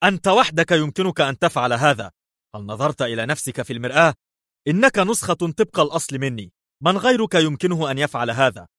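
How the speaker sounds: high-pitched, agitated accusation; agitated outburst. A narrator's reading. Levi accusing his look-alike, highly agitated. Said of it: "ولكن ليفي اندفع يقول في عصبية".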